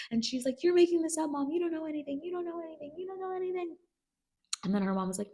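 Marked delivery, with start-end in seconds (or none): 0.6-3.8 high whine climbing and fading; frantic denial. Retold or imitated. Rue denies it all to Mom, frantic high whine. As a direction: imitate a panicked daughter insisting over and over.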